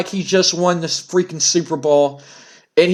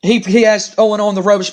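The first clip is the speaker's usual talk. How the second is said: usual speaking voice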